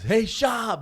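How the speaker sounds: loud, high holler of a fan; fan calling out. Retold or imitated. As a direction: imitate a fan yelling a greeting. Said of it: "the one guy goes".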